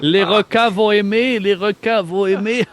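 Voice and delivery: put-on high, sing-out German-accented French. Guard joking about sharks below the toilets, German accent. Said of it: "d'un accent allemand".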